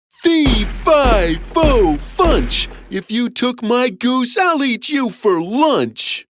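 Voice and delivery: high, booming chant with stretched words; threatening giant. A narrator's reading. Giant to whoever took his goose, threatening. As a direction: menace in a big storybook giant voice. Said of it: "The giant saw that his goose".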